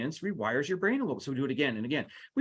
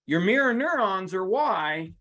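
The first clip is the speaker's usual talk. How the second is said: ordinary delivery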